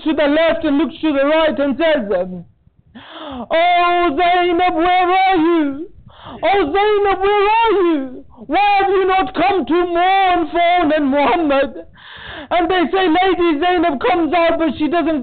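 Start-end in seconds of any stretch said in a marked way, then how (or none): 3.5-11.8 mourning chant with a catch of tears; wailing lament. Acted. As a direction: call out for her like a brother in mourning. Imam's lament over the martyrs.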